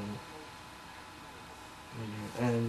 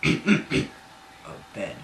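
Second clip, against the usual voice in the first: put-on low voice, musing to himself; deep voice; 3 semitones lower than usual. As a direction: clear the throat and go deep. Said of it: "he deeply said to himself".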